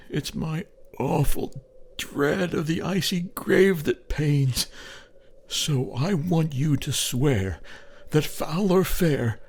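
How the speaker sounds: high, moaning voice breaking into whispers; moaning dying man. A narrator's reading. Dying Sam begs Cap to cremate him, moaning. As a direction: moan it out, shivering and afraid. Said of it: "he says with a sort of moan".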